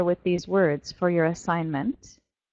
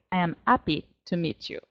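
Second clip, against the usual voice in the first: put-on French-accented "happy" with the H dropped; 3 semitones lower, 4 dB quieter than usual. Teacher imitates francophones greeting someone, French accent. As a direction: drop the H of "happy", French style. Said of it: "They say".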